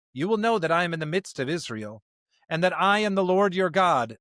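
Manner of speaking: normal talking voice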